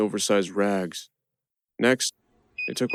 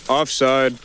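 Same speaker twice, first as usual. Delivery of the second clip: raised, louder call; lightly yelled, higher pitch; mild yell; 4 semitones higher, 5 dB louder than usual. A narrator's reading. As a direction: call it out like a guard. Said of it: "they would yell".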